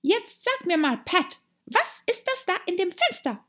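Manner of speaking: high, insistent little-animal voice; bossy Rabbit. Acted. Rabbit questioning Pat about the window, high and bossy. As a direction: demand an answer in a high voice.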